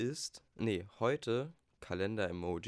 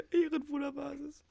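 shrieking jackpot winner; 4 dB louder than usual. Acted. Repeats brag in high voice.

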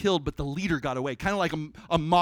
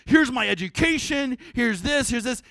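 mock bragging; 7 semitones higher, 6 dB louder than usual. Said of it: "He doesn't start".

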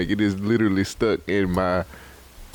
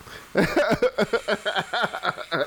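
high giggle; 9 semitones higher than usual. Real, not acted. Laughs at own crystals idea.

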